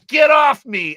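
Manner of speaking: loud, high acted outburst; mock angry shout. Girl throwing the boy off her, acted angry shout. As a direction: act out shoving someone away with a shout.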